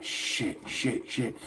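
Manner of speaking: whispered, under-the-breath shit shit shit; barely voiced private panic; hushed panicked swearing. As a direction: mutter it to yourself in a panic. Panicked inner thought, quietly voiced.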